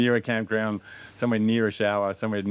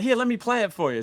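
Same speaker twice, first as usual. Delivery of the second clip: hyped-up filmmaker; 14 semitones higher, 4 dB louder than usual. Retold or imitated. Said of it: "I'm like".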